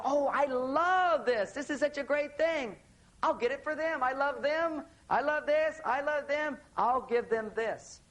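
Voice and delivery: high, excited gushing; gushing shopper. Retold or imitated. Imitating a gift buyer to audience, high and gushing. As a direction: coo over the gift like a thrilled shopper. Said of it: "we go".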